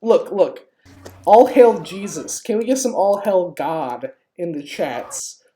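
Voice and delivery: ordinary talk, nothing marked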